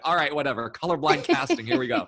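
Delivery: high, loud shrugging sarcasm; mock resigned. Actor recalling being cast as an Irishman, sarcastic shrug. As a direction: toss it off like a shrug. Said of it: "I was like".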